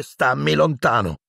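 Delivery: high-pitched contemptuous rebuff; scornful. A narrator's reading. Harry repelling Pettigrew's hands, scornful rebuff. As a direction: push him away with disdain. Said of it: "disse Harry sprezzante".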